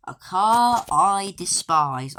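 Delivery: loud, slow scornful announcement; mock disdain. Real, not acted. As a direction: spit it out slowly with contempt. Announcing a hated car.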